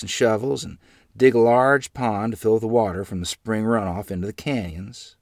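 usual speaking voice

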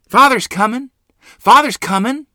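very high, very loud joyful yell; excited yell. A narrator's reading. Boy yelling to his mother that Father is coming. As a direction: shout it out as the wagon comes in sight. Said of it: "I yelled".

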